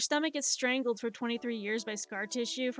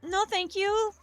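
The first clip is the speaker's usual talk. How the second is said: prim refusal; 7 semitones higher, 4 dB louder than usual. Retold or imitated. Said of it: "I'm just like".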